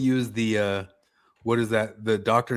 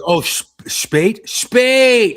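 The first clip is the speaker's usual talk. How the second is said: hammed-up shout, leaping much higher; mock German outburst; 10 semitones higher, 10 dB louder, much slower than usual. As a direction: build from questioning to a big mock yell. Hammed-up German outburst mid-conversation.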